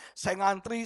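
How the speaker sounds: ordinary talk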